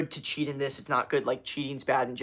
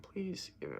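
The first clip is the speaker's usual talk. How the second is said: soft words breaking into a higher pitch; voice crack; 6 semitones higher, 15 dB quieter than usual. Real, not acted. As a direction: voice cracks upward, quietly. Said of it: "you hear that voice crack".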